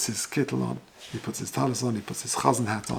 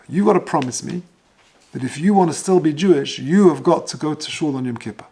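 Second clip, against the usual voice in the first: louder, emphatic fatherly command; insistent charge; 3 semitones higher, 6 dB louder than usual. Retold or imitated. Father on his deathbed making his child promise, retold with forceful insistence. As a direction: press the promise home forcefully.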